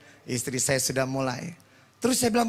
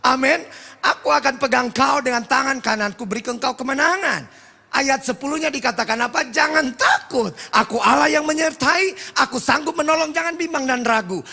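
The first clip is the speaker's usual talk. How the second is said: raised, higher sermon delivery; fervent preaching; 8 semitones higher, 4 dB louder than usual. Real, not acted. Preacher quotes Isaiah to congregation, fervent proclamation.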